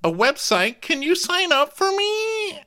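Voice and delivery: high helpless whine; mock whiny plea. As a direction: ham up a helpless grown woman. Mocking a flaky friend.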